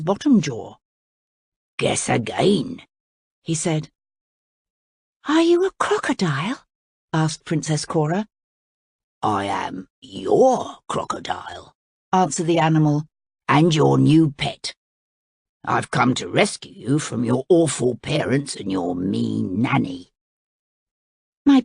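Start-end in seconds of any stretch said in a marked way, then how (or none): 1.8-2.8 low, slow sly crocodile; pleased crocodile teasing the princess, low and slow. A narrator's reading. Voices the crocodile. 5.3-6.7 put-on high curious girl's question; young princess. A narrator's reading. Voices the princess. 9.2-11.7 low, slow sly crocodile; pleased crocodile teasing the princess, low and slow. A narrator's reading. Voices the crocodile. 13.5-20.1 crocodile voice. A narrator's reading.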